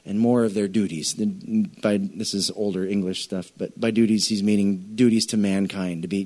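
ordinary talk

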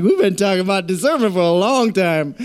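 playful Eddie Murphy impression, barely shifted. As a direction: loosely mimic Eddie Murphy with a cheesy grin. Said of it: "That's my Eddie Murphy accent".

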